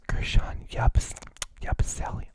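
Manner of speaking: breathy ASMR whisper into the mic; mock ASMR whisper. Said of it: "first A S M R Celtic podcast".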